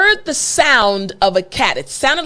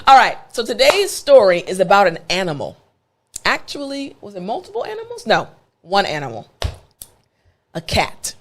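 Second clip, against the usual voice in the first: ordinary talk, nothing marked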